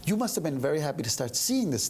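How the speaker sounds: ordinary talk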